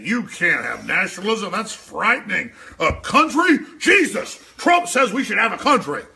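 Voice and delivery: high, loud shrill mockery; loud, high mock panic of his critics; mocking globalists. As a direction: ham up their outrage. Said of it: "everybody said".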